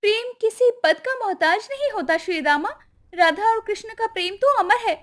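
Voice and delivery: high, earnest goddess voice; Radha, proudly defending love. Acted. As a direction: answer the accuser with conviction.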